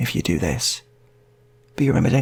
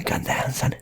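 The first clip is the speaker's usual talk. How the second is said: ordinary talk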